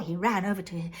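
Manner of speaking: plain reading voice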